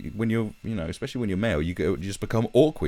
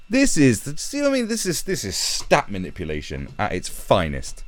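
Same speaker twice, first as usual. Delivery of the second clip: ordinary talk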